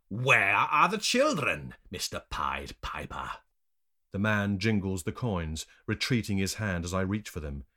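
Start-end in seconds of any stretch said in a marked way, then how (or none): 0.1-3.3 slow, loud taunting question; pointed, raised-voice taunt; taunting demand. A narrator's reading. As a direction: draw the question out mockingly. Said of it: "taunting me with a pouch of gold coins".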